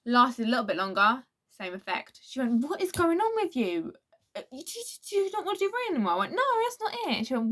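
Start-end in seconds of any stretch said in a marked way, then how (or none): 2.6-6.1 mum's quizzing voice pitched well up, hurried at the end; exasperated mum. Retold or imitated. Mum questions her daughter after a bad race, pitched much higher, exasperated. 6.3-7.2 defensive protest. Retold or imitated. Girl denies to her mum she has lost interest, high defensive protest.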